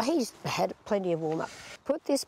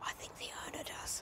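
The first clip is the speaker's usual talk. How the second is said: whisper; 16 dB quieter than usual. Real, not acted. Joking in whispers.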